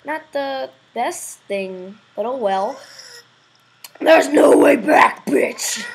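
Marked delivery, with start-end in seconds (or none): 4.0-5.9 loud, slow devil growl. Acted. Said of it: "like the devil voice".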